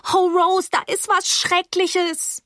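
high, stretched-out wail; high, desperate girl's voice; panicked alarm. Acted. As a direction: wail the news in distress. Frantic girl repeats alarm.

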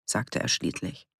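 plain reading voice

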